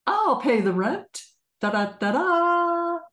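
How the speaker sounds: bright, triumphant hero with fanfare; heroic rescuer. Shown as a demonstration. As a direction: sweep in to save the day. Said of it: "Here comes Victor".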